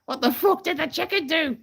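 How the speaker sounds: very high parrot squawk; sweary parrot. Acted. Thawed parrot questioning the sergeant, high squawk. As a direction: squawk the punchline in a parrot screech. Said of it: "And the parrot goes".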